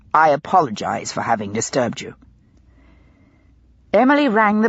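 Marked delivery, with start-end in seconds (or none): 0.1-2.2 lower, held-back gentle apology; forced civility. A narrator's reading. Pitt apologizes stiffly to the fainted lady. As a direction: swallow the anger and be polite. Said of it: "forced himself to be civil, even gentle".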